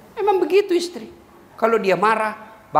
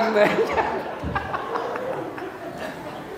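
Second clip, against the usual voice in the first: breathy laugh; 14 semitones higher, 6 dB quieter than usual. Real, not acted. Laughs at his own joke.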